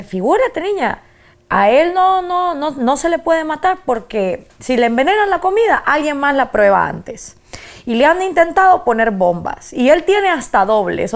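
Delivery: a hint of abuela explaining Fidel to the girl; imitating Cuban grandmother. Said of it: "Y me dice".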